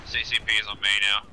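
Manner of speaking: loud announcing call; loud callout. Real, not acted. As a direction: call it out loud to the whole team. Announcing casualty point to team.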